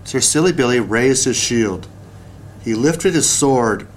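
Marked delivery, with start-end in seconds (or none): none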